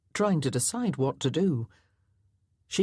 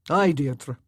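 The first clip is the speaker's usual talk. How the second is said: lightly voiced laird, louder; 5 dB louder than usual. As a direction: concede to her. Laird answers.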